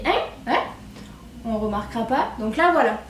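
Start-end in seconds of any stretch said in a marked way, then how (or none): 0.0-0.6 "hein" popped out short with a sharp rise in pitch; insistent accent on "hein". Shown as a demonstration. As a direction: lean on the nasal vowel of "hein", pitched up like a question. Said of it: "Mon accent, euh, est un peu insistant sur les".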